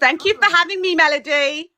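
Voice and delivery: high, laughing thank-you. Real, not acted. Guest thanks host Melody while laughing. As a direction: bubble over with laughter as you thank her.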